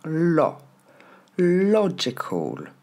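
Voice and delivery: high LO alone, then LOGICAL slowly; drawn-out LO, falling "col"; first-syllable stress. Shown as a demonstration. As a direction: isolate LO, then punch it in "logical". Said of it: "L'accent tombe sur la première".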